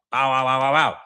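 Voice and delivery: rapid run of mouthed gunfire; imitated gunshots. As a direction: mimic shots ringing off with the voice. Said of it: "That's when the gunshot rang off".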